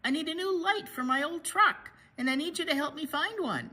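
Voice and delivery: loud, excited announcement; eager call. A narrator's reading. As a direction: sell the road trip with energy. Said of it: "called Bear".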